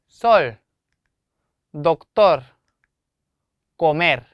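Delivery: sol, doctor, comer with a loud final vowel; teacher's word-by-word demo, last syllable stressed. Shown as a demonstration. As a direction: punch SOL, the TOR of doctor and the MER of comer. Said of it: "이렇게 발음을 하시면 됩니다".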